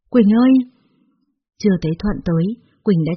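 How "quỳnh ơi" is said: raised, higher-pitched call. A narrator's reading. Boyfriend arriving at hospital, calling out to her. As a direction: call her name across the corridor.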